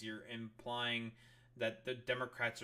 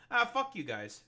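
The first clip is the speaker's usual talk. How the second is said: a sudden higher, louder mocking brush-off; mock dismissive jab; 9 semitones higher, 6 dB louder than usual. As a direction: sneer it out as if you were them. Mock voicing of politicians' attitude.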